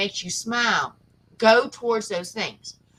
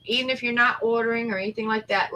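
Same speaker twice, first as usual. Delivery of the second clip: usual speaking voice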